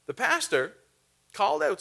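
normal talking voice

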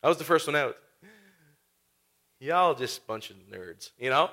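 low, soft, smug brag with a laugh; smug Peter. Pretend. Peter mocking the other disciples, low smug brag. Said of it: "Peter's like".

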